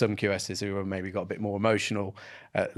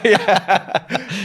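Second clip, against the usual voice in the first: a yes through a high laugh; laughing yeah; 11 semitones higher, 10 dB louder than usual. Real, not acted. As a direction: laugh the word out. Laughing at joke.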